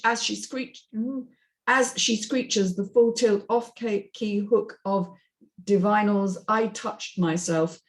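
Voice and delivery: ordinary talk, nothing marked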